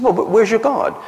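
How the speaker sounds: high, loud jeer opening on "Well"; mocking taunt. Elijah jeering at the priests of Baal, loud mocking taunt. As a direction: needle them with a mock-sympathetic "Well". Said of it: "Elijah taunts".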